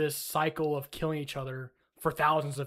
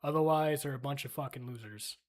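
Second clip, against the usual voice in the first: normal talking voice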